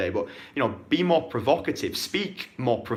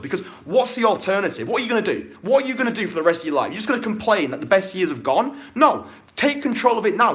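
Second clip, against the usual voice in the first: angry rant; 6 semitones higher than usual. Real, not acted. Coach yelling at wallowing viewers, angry. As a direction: chew them out, fired up. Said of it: "rant over".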